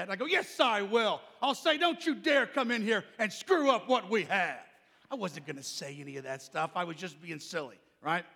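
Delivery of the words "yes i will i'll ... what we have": loud, high, brash boast; acted-out cocky teenage hollering. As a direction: holler it defiantly to a friend in back. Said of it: "and so I was hollering".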